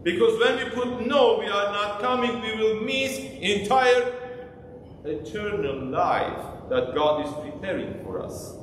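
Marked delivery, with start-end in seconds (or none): none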